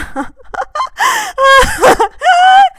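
very high, loud laughing scream. Acted. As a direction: laugh that breaks into a shriek.

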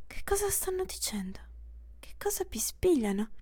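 soft whispered inner voice; drowsy inner whisper. Acted. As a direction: whisper to yourself.

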